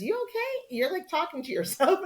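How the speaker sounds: high voiced concerned husband; puzzled, light mock husband voice; imitating a worried husband; husband checking in. Husband finds her talking alone.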